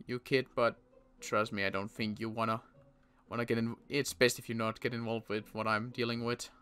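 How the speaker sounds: ordinary talk, nothing marked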